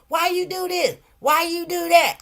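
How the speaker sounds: high, loud nagging questions; shrill-toned mock nagging; nagging critic mock. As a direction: mimic a judgmental chat.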